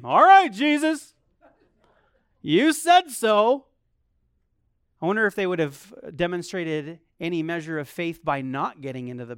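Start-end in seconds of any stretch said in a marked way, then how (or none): 0.0-3.7 higher, louder, slow sarcastic agreement; mock hearty reply; mock cheerful disciples. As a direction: play the naive disciples going along.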